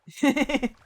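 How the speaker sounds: short loud chuckle, pitched high; brief real burst of laughter; laughing. Real, not acted. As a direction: let out a quick, loud laugh. Laughs at being back again.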